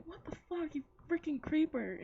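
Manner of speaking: high-pitched, appalled reaction; mock outrage. Viewer reacting to the creep laughing in his car, appalled.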